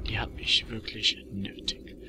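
soft breathy whisper. Real, not acted. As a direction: whisper it to yourself. Repeats line as a whisper.

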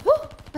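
sudden high startled yelp; short high squeal of fright. Real, not acted. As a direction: jump at a surprise. Sudden enemy appears.

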